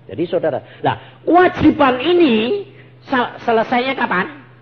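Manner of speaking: loud, high, pounding sermon delivery; fiery preaching. Acted. Preacher urging the congregation, loud and high. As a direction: preach it with full force to the congregation.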